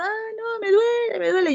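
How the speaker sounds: high, whiny imitation of a sick child; mock crying child. Doctor imitating a feverish toddler to students, mock high whimper. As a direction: play a child whimpering in pain, a bit hammed-up.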